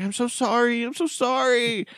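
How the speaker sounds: put-on a nervous young Indian woman apologizing, way up high; Indian accent. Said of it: "She had the typical, like, Indian accent".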